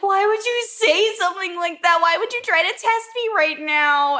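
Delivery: high-pitched imitation of a crying plea; tearful protest. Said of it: "starts crying, and he's like".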